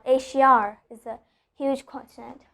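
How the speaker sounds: "Asia" with R added at the end; intrusive R. Shown as a demonstration. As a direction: join "Asia is" with an R. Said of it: "So I'm adding an R".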